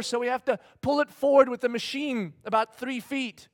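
ordinary delivery

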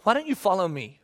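a lightly voiced Jesus calling Peter; gentle Jesus voice. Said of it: "Jesus looks at Peter and says".

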